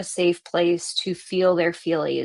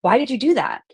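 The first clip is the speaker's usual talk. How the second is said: accusing why, raised and louder; a pointed why that shames the listener; shaming why; 6 semitones higher, 4 dB louder, faster than usual. Shown as a demonstration. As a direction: put blame into the why. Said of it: "what a shamey, blamey question".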